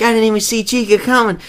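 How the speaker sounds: high, fast mock complaint; hammed-up rushed whine; imitating Markiplier. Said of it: "He's like".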